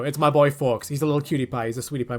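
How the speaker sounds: ordinary talk, nothing marked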